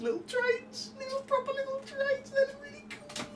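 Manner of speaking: high delighted squeal about trains; excited squeal. Real, not acted. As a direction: gush in a very high voice. Gushing about toy trains.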